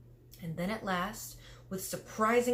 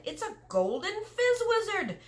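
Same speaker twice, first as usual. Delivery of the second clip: much higher, louder giant cry on fizz; excited cry; 4 dB louder than usual. Acted. Giant showing Sophie his prize, delighted high cry. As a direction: burst out in delight, stressing fizz. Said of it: "he cried".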